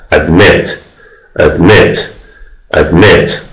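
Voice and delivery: ادمت with the weight on the last syllable MET; stress on second syllable. Shown as a demonstration. Teacher to students: admit, second syllable stressed. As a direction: lean on met of ادمت, rising. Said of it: "هجای دومه یا هجای آخر فشار آوردم".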